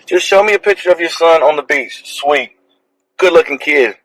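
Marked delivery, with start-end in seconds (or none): none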